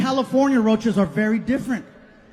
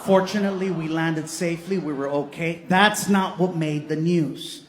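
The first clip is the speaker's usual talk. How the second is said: usual speaking voice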